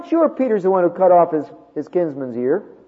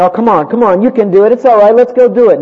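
louder, pressing crowd voice; urging crowd; 7 semitones higher, 10 dB louder than usual. Acted. Crowd pressures a believer to join in, loud and coaxing. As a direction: egg him on, loud and coaxing.

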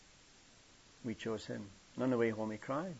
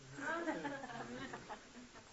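a high breathy chuckle; soft high-pitched laugh; 7 dB quieter than usual. Real, not acted. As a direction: laugh lightly at the punchline. Laughing at his own punchline.

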